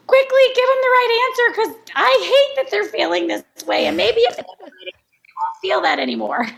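high, frantic voice hurrying to fix things; anxious rushing voice. Retold or imitated. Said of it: "where we're like".

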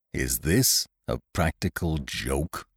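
low, slow, chilly question; cold, measured displeasure; icy disapproval. A narrator's reading. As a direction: ask it slowly and quietly, with menace. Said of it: "in a way that set about sixteen drafts".

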